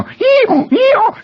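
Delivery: very high, loud hee-haw; donkey braying. Retold or imitated. Boy practicing his donkey part for the class play, loud high braying. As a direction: bray like a donkey at full voice. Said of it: "making it sound like a donkey".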